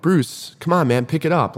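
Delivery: mock shout up the trail to a lagging teammate; retold yell. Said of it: "So I yelled".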